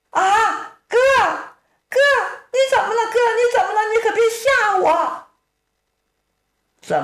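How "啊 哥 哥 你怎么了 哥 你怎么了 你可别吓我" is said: put-on high, alarmed young woman calling out; a sister suddenly scared for her brother, high; frightened sister. Sister calling to her fallen brother, high and frightened.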